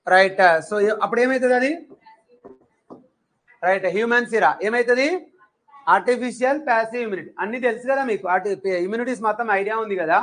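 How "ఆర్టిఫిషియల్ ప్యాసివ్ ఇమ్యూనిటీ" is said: quick, run-together recitation; rattled off fast. Shown as a demonstration. Teacher showing students how they'd rattle it off, fast. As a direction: fire it off like a memorised answer. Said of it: "గబా గబా చెప్పేస్తారు కదా".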